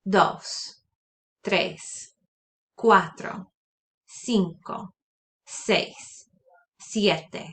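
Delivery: numbers said slowly for repeating, one at a time; Spanish counting drill. Shown as a demonstration. Teacher models Spanish numbers for students to repeat. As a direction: model each number clearly for the class. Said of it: "So repeat after me".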